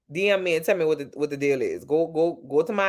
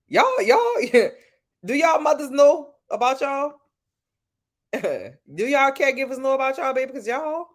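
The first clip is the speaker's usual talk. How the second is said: laughing tease; 9 semitones higher than usual. Real, not acted. Teasing chat, laughing.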